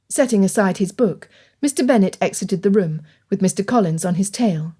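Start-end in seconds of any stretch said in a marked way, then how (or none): none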